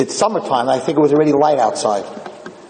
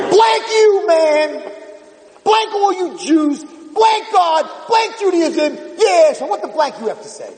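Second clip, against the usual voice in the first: put-on an angry teenage girl shouting at the rabbi; furious shouting teen; 13 semitones higher, slower than usual. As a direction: hurl the curses at him, much higher. Pierced girl cursing at rabbi.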